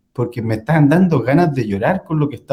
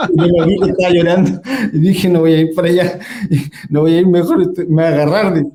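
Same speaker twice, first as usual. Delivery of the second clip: retold through laughter, higher; laughing; 4 semitones higher than usual. Telling a friend how he dodged the crying doorman, laughing. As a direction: laugh while recalling the panic. Laughing retelling his own panic.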